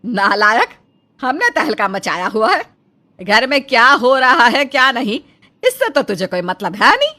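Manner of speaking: तिलमिलाकर ऊँची आवाज़ में डाँटना; बेटे को गुस्से से तिलमिलाकर फटकारो. Acted. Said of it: "गुस्से से तिलमिला उठती है". (बेटे पर भड़कती माँ, ज़ोर से डाँटती हुई)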